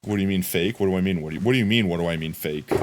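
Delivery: ordinary talk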